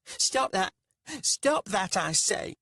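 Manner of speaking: high, loud spluttering order; indignant shout. A narrator's reading. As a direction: snap at them, outraged. Rika shouts at mocking bandits.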